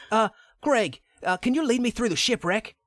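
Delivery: a hammed-up British Lara asking nicely; Lara in British accent. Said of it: "I don't know why that's a dude".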